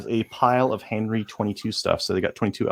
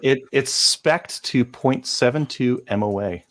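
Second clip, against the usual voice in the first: usual speaking voice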